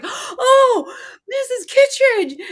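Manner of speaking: high, gushing former student, then a loud baffled comeback; mock excited greeting. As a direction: squeal the name, then blurt the question with a laugh. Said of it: "they see you on the street and they're like".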